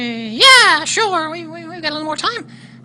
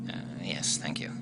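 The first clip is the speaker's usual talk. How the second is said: whispered dummy voice; a hushed, polite dummy; whispering; 16 dB quieter than usual. Acted.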